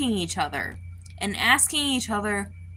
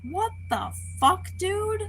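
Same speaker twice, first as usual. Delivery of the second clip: shocked squeak; 11 semitones higher than usual. Retold or imitated. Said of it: "And I'm like".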